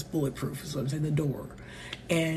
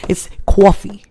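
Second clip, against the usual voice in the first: put-on Connecticut accent, "coffee" as kwawfee; 3 semitones higher, 9 dB louder than usual. Correcting her friend, Connecticut "coffee" accent. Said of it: "some West Coast stuff".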